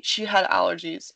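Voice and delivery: usual speaking voice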